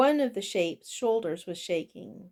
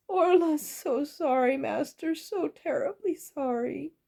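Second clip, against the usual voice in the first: high, pleading apology; grovelling goblin; 7 semitones higher than usual. A narrator's reading. Goblin cook on her knees before master, grovelling. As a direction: beg forgiveness in a high voice. Goblin kneels and begs forgiveness.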